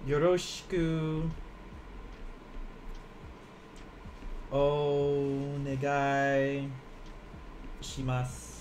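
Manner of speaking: very slow, drawn out syllables; slow demo. Shown as a demonstration. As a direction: spell it out slowly. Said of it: "but this will be".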